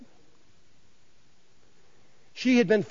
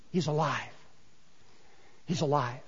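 softer, lower, overwhelmed whisper-like awe; awed hushed repetition; 6 semitones lower, 12 dB quieter than usual. Acted. Mary holding Jesus' legs, hushed in awe. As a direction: breathe it out in tender wonder.

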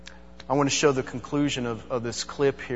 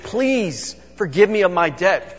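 higher, louder plea; pleading; 6 semitones higher, 5 dB louder than usual. Acted. Debtor begs the man to forgive his debt, pleading. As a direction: beg for mercy, voice lifted. Debtor begging in a retold parable.

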